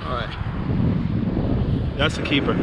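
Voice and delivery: ordinary delivery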